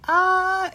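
very high imitated "ah"; mock big laugh cry. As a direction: mimic a friend's over-the-top high cackle. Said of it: "You go".

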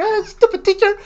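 high, laughing imitation of an annoyed wife; mock angry wife. Wife complaining about the teacher, mock annoyed. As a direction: ham up her irritation while laughing. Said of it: "me habló toda enojada".

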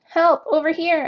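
high, loud call for rescue; cried for help. A narrator's reading. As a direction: cry out to be found. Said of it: "I cried".

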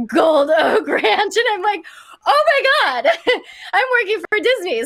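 high, squealing laughter through the words; giddy laughing squeal; laughing with excitement. Real, not acted. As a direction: laugh it out in disbelief. Discovering Disney set, laughing.

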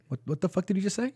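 mock shocked clerk. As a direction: imitate her stunned reaction. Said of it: "her face went from".

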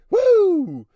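very high, loud whoop. Real, not acted. Podcaster whooping before the outro, very loud. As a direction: let out a wild whoop.

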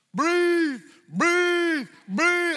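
high, loud, shouted breathe calls; mock shouting coach. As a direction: bark it like a mocking swim coach. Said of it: "He didn't say".

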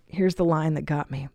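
ordinary talk, nothing marked

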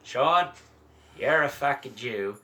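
badly faked Australian friend, drawn out; horrible Australian accent. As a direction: do a bad Australian, slowing down. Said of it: "let me do a horrible Australian".

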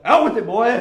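hammed-up shout to make him spill it; impatient demand. Teen counselor to crying boy, impatient shout.

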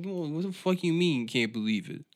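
usual speaking voice

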